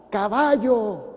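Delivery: loud, high warning yell; shouted warning. Acted. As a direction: shout it out like a joke's punchline. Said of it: "la señora le grita".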